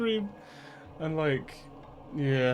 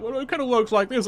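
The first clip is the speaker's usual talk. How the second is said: higher, louder know-it-all dad voice; mock lecturing dad; 9 semitones higher, 6 dB louder than usual. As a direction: mimic dad explaining the obvious, higher and louder. Dad lectures about kettle again.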